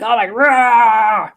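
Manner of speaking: loud, high, slow hammed-up roar; mock rage. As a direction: blow up like losing it. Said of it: "you would have lost your shit".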